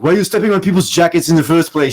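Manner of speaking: loud, high, indignant mock-French outburst; imitated mad Frenchman. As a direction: play the Frenchman all worked up. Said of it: "He's being all mad".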